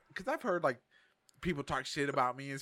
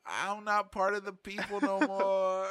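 mock heartbroken; 6 semitones higher, much slower than usual. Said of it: "I'm like".

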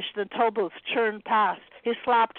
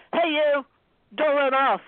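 loud, high call; 5 semitones higher, 3 dB louder than usual. A narrator's reading. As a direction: call out after her. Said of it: "called the lady".

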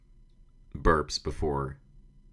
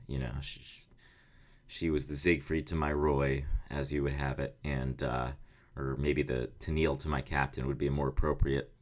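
ordinary delivery

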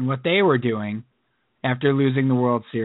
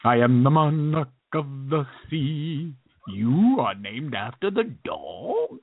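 slow, grand Sallah from Raiders; hammed-up Sallah, slowing right down; Sallah impression; slower than usual. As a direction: stretch the words out like a booming Sallah. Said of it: "He's sort of like Saleh in Raiders of the Lost Ark".

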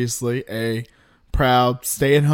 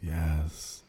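spooky voice; 5 semitones lower, 8 dB quieter than usual. Pretend. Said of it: "It's my spooky voice".

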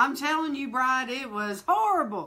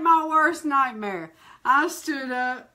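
ordinary talk, nothing marked

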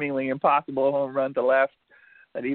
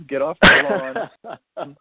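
put-on louder, slow cranky old man yell; grumpy old man; 8 dB louder, slower than usual. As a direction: bark it like an old man shooing kids. Voicing old players as grumpy men.

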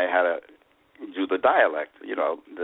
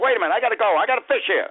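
mock urgent; 13 semitones higher, faster than usual. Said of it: "So I said".

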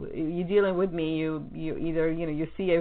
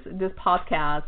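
normal talking voice

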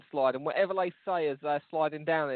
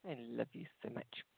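tender murmur; 6 semitones lower, 14 dB quieter than usual. Real, not acted. Tender aside to partner.

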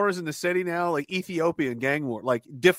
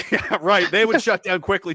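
ordinary talk, nothing marked

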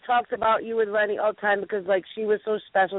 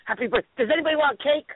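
higher-pitched cake offer breaking off a song; higher mimicking voice offering cake; imitated party interruption; 5 semitones higher than usual. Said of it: "sing it like this".

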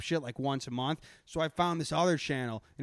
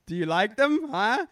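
mock Australian accent; 5 semitones higher, 6 dB louder than usual. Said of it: "I can't even do their dumb fucking accent".

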